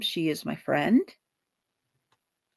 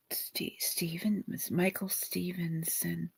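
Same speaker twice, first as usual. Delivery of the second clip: near-whisper, feeling for the name; very soft and halting, as if listening inwardly; hushed sounding-out; 14 dB quieter than usual. Real, not acted. Medium trying out the spirit's last name to herself, hushed.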